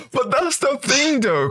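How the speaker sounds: words squeezed out through real laughter; laughing. Real, not acted. Laughing at the friend while pushing back, through laughter. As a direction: still laughing as he starts his point.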